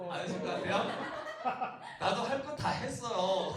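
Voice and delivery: laughing through the words. Real, not acted. Pastor joking about his own proposal, laughing. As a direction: giggle while protesting.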